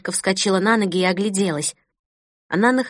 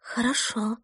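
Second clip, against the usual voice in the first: breathed out, much softer; barely audible whisper; 2 semitones higher, 8 dB quieter than usual. Acted. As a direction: hardly dare to say it aloud. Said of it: "еле слышно прошептала".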